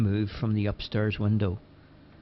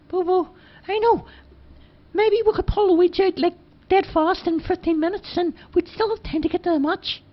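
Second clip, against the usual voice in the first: put-on high, louder boyish voice; Charlie's voice, eager; 5 dB louder than usual. A narrator's reading. Charlie pleading with Bobo, high eager boyish voice. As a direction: pitch it up, keen to get going. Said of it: "at the ground and said".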